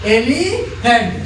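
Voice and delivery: Elephant drawn out slowly; slow model word. Shown as a demonstration. Teacher slowly showing how to say elephant. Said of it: "ऐसे करके".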